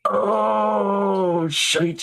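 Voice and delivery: high, nervous excuse-making; flustered dwarf. Acted. Dwarf backing out of an awkward talk, high-pitched and flustered. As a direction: squeak out a hurried exit in character.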